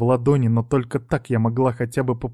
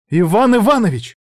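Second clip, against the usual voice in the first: high, loud, losing control; breaking into a shout; 7 semitones higher, 6 dB louder than usual. A narrator's reading. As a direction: shout the name, at the end of her rope. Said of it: "сорвалась на крик".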